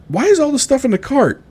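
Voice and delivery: high-pitched, accusing bewilderment; baffled wife. Retold or imitated. Wife looking at her husband's cart, baffled and accusing. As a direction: imitate a puzzled wife, pitch leaping up.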